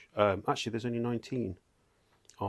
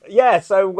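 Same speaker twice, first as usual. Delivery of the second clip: usual speaking voice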